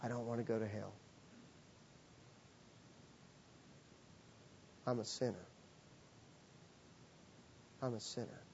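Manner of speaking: very soft, low retold plea, long pauses; hushed, understated despair without sobbing; grave confession. Injured man confessing from his hospital bed, quietly grave. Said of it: "he just immediately started crying".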